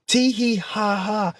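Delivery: high, slow sing-song mock giggle; mimicking people who laughed at him. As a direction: taunt them with a silly tittering tee hee ha ha. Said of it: "you laughing at that shit".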